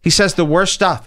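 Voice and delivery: ordinary delivery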